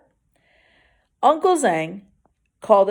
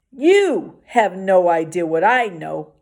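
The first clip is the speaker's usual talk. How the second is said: ordinary delivery, nothing marked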